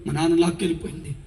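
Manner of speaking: ordinary talk, nothing marked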